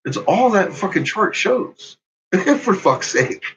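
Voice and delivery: ordinary talk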